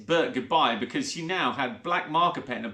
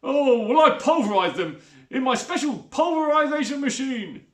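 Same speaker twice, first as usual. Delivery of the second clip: high, eager voice of a sly rat catcher; a grinning villain showing off his machine; Bert, sly rat catcher; 10 semitones higher, 6 dB louder than usual. A narrator's reading. Bert brags about his machine.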